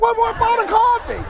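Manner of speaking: very high, loud laughing shout. Retold or imitated. Keeping witnesses for coffee.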